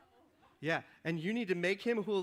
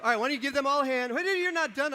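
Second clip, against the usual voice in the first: excited rallying; 7 semitones higher, 8 dB louder, faster than usual. Real, not acted. Hosting, rallying applause.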